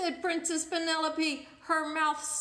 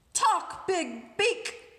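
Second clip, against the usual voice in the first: higher, louder bossy order; demanding princess; 5 semitones higher, 9 dB louder, much slower than usual. Acted. Princess ordering the silent parrot, bossy loud command. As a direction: order the bird around. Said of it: "demanded the Princess Penelope".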